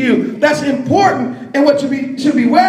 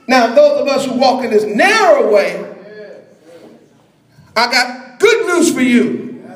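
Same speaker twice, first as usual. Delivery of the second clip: ordinary delivery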